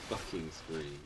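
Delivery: ordinary talk, nothing marked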